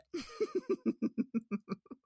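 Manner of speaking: high, quiet giggle; soft run of giggles at oneself; embarrassed laugh. Real, not acted. Host laughing at their own bad accent, embarrassed giggle.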